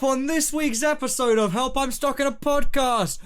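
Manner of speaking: high, slow, loud trailer voice; hype announcer. Acted. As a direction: sell the episode like a promo. Podcast trailer intro.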